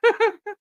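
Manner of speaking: high squealing laugh; shrieking laugh. Real, not acted. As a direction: let out a shrill laugh. Reacting to viral post.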